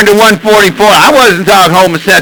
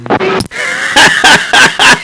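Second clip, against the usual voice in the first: high, breathless laugh bursting out; burst of laughter; 7 semitones higher than usual. Real, not acted. Trucker laughing at the CB banter. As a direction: crack up at the joke.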